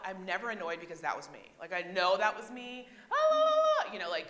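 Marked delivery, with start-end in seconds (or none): 3.1-3.9 put-on much higher, much louder squeal of a giggly girl; silly shrieky schoolgirl singsong; loud middle school girls. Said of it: "middle school girls... loud, that was me".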